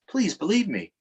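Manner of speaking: ordinary delivery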